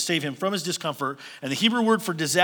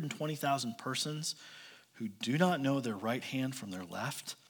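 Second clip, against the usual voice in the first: solemn God; 5 semitones lower, 10 dB quieter than usual. Retold or imitated. God speaking softly and solemnly to Jonah. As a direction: draw him close and quietly ask. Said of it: "And then God says".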